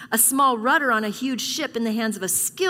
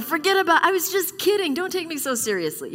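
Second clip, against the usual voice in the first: higher, louder, quick nervous backtrack; nervous backpedal; 8 semitones higher, 3 dB louder than usual. Retold or imitated. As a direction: laugh it off, voice jumping up. Voicing her own backpedalling after words.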